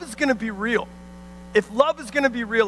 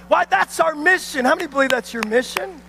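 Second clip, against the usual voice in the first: ordinary talk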